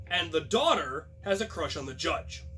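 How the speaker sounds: plain reading voice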